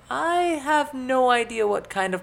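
put-on very high, loud girlish voice; cheerful warning. A narrator's reading. Elizabeth cautioning companions about the dog, cheerful high voice. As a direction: warn while still smiling. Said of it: "still smiling".

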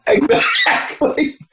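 a breathy laugh through the word; Exactly, dissolving into laughter. Real, not acted. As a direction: laugh it out, amused. Laughs at dating himself.

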